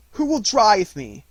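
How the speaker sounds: slow, high, loud challenge; boy challenging the old wolf loudly. A narrator's reading. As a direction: dare anyone to try. Mowgli challenges.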